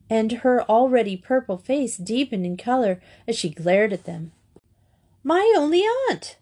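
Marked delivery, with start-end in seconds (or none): 5.2-6.4 high, swooping exclamation of amazement; astonished gasp. A narrator's reading. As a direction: gasp it out, thunderstruck. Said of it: "gasped Grisel".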